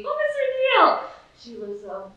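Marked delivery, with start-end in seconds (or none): 0.0-1.1 high, loud, drawn-out question; rhetorical showman question. Real, not acted. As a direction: stretch it out, pitched way up. Mock-rhetorical eulogy opening.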